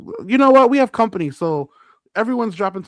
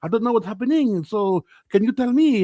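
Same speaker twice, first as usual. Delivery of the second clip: put-on weird, higher French-Brazilian accent; 3 semitones higher than usual. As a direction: imitate a French-speaking Brazilian acquaintance. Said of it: "a weird French Brazilian accent".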